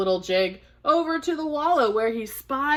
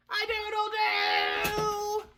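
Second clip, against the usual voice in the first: high, softer crow with long tail; rooster crow; 11 semitones higher, 6 dB quieter than usual. Acted.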